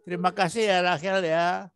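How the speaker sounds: lightly voiced tender mother; gentle request. Shown as a demonstration. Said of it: "Jangan begitu, tapi".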